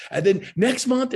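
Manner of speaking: and then next month, said big and high; hyped announcement. Shown as a demonstration. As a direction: sell the next event with a build. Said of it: "it's always, and then next month".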